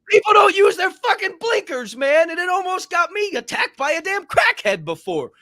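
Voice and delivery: high-pitched comic tirade; exasperated rant. Pretend. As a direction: rant at inconsiderate people, voice pushed way up. Heated rant about blinkers.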